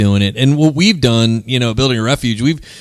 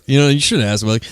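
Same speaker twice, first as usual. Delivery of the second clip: ordinary talk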